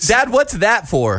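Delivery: put-on curious kid. Kid asking about commercial.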